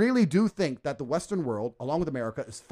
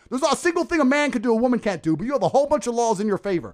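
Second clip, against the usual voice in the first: usual speaking voice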